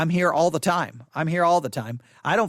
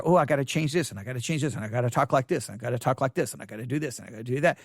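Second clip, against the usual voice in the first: mock fretting; 7 semitones lower, 3 dB quieter, faster than usual. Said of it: "You can sit there and say".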